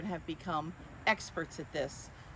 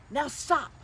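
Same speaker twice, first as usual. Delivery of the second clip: higher, louder sharp order; firm command; 7 semitones higher, 7 dB louder than usual. Acted. Max halting the wild things, a sharp order. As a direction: shut the party down. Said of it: "Max said".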